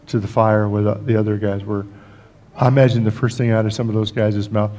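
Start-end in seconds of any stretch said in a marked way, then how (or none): none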